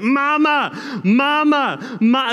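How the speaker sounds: a half-mock cry for mom from the dentist chair; toddler yelling mama. Dad imitates toddler yelling for her mother. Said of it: "it just got louder and louder".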